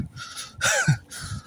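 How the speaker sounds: squeaky breathy laugh; a high burst of laughter; quick high giggle. Real, not acted.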